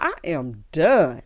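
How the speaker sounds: ordinary delivery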